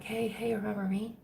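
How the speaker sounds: whispering "hey, hey, remember me?"; whispered spirit voice. Retold or imitated. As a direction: breathe it like a spirit in your ear. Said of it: "higher self's trying to get a hold of you".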